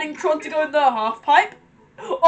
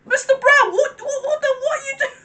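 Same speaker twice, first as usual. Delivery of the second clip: shocked outcry; 9 semitones higher than usual. Real, not acted. Shocked reaction to the show.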